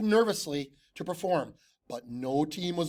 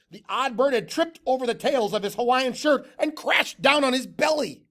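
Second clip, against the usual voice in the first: usual speaking voice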